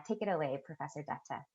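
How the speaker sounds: ordinary talk, nothing marked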